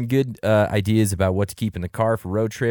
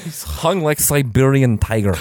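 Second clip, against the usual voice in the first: put-on Russian accent; 5 semitones higher, 4 dB louder, slower than usual. Russian accent bit continues.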